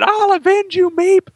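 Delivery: high, hammed-up cry for vengeance; mock scream. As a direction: wail it like a grieving hero. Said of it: "running around town screaming".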